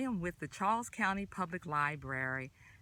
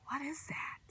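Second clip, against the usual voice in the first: whisper; 7 semitones higher, 6 dB quieter than usual. A narrator's reading. Teasing the next food.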